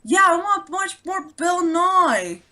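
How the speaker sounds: high, slow, eager little voice; toddler voice. Retold or imitated.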